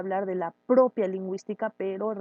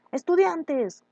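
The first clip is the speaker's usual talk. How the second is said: put-on high, loud call to the children; teacher calling niños across the room; 8 semitones higher, 5 dB louder, slower than usual. Shown as a demonstration. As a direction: call the kids to attention. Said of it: "Los vocativos".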